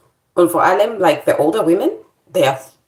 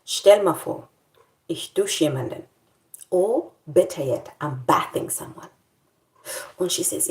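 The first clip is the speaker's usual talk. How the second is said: usual speaking voice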